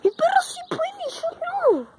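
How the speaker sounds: high, loud frustrated yell; frustrated shout. Real, not acted. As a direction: shout it out, outdone by the dog. Dog can do parkour, he can't.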